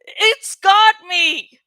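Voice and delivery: loud, slow cry for help; yell. A narrator's reading. Marco caught by the creature yells to Jake, loud yell. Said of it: "I heard Marco yell".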